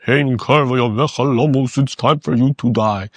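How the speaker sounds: low, blunt pronouncement of death; blunt death sentence. Retold or imitated. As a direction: hand down the verdict coldly. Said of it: "That's not how he said it".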